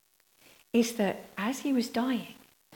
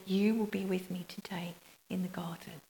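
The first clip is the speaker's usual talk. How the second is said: whispered; 4 semitones lower, 8 dB quieter than usual. Acted. Quoting Jesus's promise, whispered.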